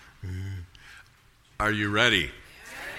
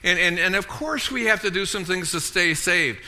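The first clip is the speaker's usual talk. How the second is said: usual speaking voice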